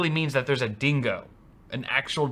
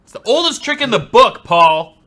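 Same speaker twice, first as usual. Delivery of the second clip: excited teasing; 4 semitones higher, 11 dB louder than usual. Real, not acted. Teasing co-host over missed pun.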